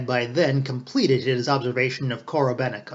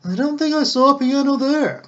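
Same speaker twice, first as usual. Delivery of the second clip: much higher, louder, skeptical inner voice; Ostap's sly thought; 13 semitones higher, 6 dB louder than usual. A narrator's reading. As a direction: doubt it privately. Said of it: "thought Ostap".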